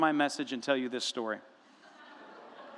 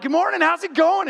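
very high, loud morning hello; yelled cheery greeting; 13 semitones higher, 9 dB louder than usual. Acted. Husband greeting a grumpy wife too loudly, yelling. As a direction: holler it like an overeager early riser. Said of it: "you just hear yelling".

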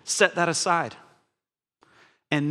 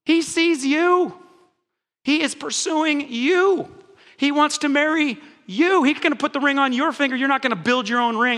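emphatic preaching; 6 semitones higher than usual. Real, not acted. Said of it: "emphatic preaching".

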